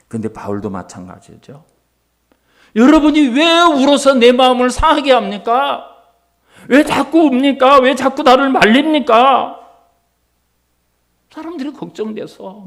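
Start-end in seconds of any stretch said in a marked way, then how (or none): 2.8-9.6 pleading rebuke. Acted. Paul rebuking weeping friends.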